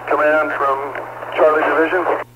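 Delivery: crackly radio call, slow and urgent; urgent firefighter radio. Real, not acted. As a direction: firefighter over a handheld radio. Fireground radio call to command.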